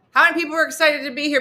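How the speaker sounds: fast, loud, high pep; hyped presenter. Retold or imitated. Presenter asking the crowd, hyped and fast.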